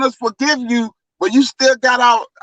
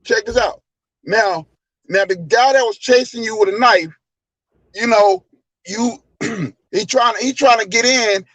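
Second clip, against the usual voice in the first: ordinary delivery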